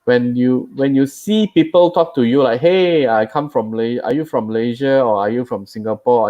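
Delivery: ordinary talk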